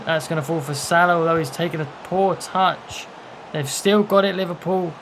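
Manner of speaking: usual speaking voice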